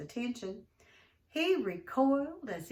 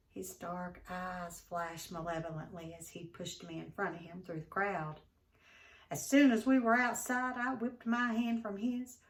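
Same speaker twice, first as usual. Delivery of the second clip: plain reading voice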